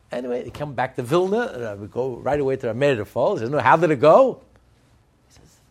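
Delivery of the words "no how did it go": loud, pitched-up eager asking; eager loud question. Retold or imitated. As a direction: call out eagerly for news.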